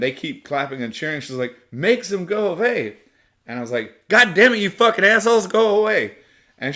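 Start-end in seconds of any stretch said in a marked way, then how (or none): 1.7-3.0 put-on girl's plea. Girl voiced again. 4.1-6.2 high loud furious shout; yelling at the crowd in anger; angry yell. Retold or imitated. Yelling at cheering soldiers.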